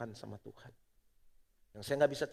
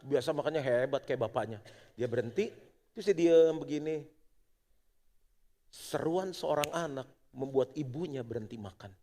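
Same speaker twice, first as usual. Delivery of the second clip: ordinary talk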